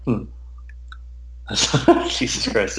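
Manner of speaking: ordinary talk, nothing marked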